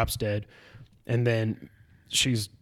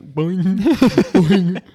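slingshot boing; 7 semitones higher, 7 dB louder than usual. Retold or imitated. Imitating slingshot shots.

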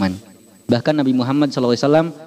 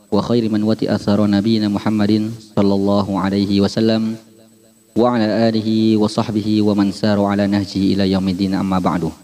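formal Arabic khutbah opening, measured; sermon opening. Acted. Preacher opening his talk to the congregation, solemn. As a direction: open the sermon with solemn recitation.

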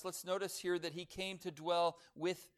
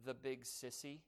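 shaky voice; 3 semitones lower, 8 dB quieter than usual. A narrator's reading. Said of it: "broken by a shaky voice saying".

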